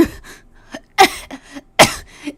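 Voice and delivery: raspy sick cough; hacking cough. Acted. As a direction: cough like a dying old woman. Grandma coughing.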